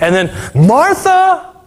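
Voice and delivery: emphatic slowed naming; drawn-out Martha. Acted. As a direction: lean hard on the name, dragging it out. Turning to the busy sister.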